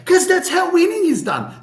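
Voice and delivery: high, loud mock tough-guy boxer voice; Sylvester Stallone voice. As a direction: ham up a movie fighter giving a pep talk. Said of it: "Sylvester Stallone".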